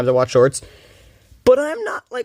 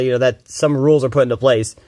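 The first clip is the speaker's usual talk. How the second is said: ordinary delivery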